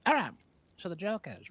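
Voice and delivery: talking around candy; words round a piece of candy; mouth full. Real, not acted. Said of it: "I'm gonna put a little candy in my mouth".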